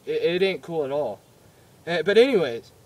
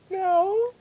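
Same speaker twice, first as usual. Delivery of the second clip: put-on whiny kid; 4 dB louder than usual. Kid answering mom.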